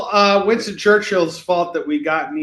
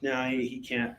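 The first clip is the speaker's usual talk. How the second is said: ordinary talk, nothing marked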